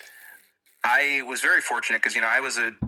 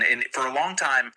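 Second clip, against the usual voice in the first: ordinary talk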